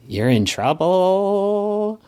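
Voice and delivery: high, drawn-out singsong from a weakened figure; weak singsong. Acted. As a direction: stretch it out slowly and high, feebly teasing. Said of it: "weakly cough".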